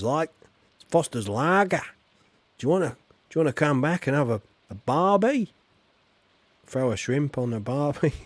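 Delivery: hammed-up Aussie accent, fast in places; a deliberately awful Australian voice; Australian accent rushed and overdone; bad Australian accent. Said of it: "my accent was bloody awful there".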